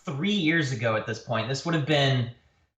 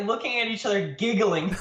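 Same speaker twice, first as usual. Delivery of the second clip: ordinary talk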